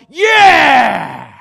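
loud shouted yeah; men of the church roaring yeah; guys' cheer. Retold or imitated. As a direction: roar it like the whole church cheering. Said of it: "we all go".

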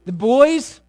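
ordinary talk

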